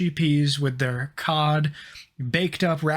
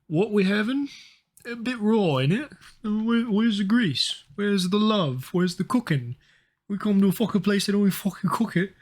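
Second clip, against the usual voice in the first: British bloke impression; 5 semitones higher than usual. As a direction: play a disgruntled Brit, up high and aggrieved. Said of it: "Average British person".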